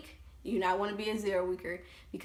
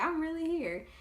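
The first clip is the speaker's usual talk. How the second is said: disbelief; 8 semitones higher than usual. Retold or imitated. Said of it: "I'm just thinking".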